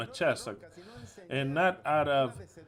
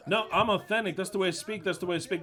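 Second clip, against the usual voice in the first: high, loud and insistent; angry loud insistence; 5 semitones higher, 4 dB louder, faster than usual. Real, not acted. Preacher defending his temper, loud indignant insistence. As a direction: insist loudly, almost shouting.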